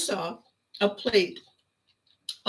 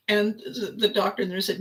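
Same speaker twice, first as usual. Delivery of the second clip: ordinary talk, nothing marked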